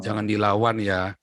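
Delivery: slow, low-pitched fatherly warning; father's counsel. Retold or imitated. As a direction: gently caution a child, unhurried. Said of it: "ayah saya selalu bilang apa".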